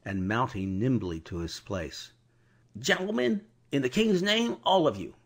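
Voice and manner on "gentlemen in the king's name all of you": high urgent command; hurried adjuration. Acted. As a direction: rush the warning in the king's name. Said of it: "With this hurried adjuration".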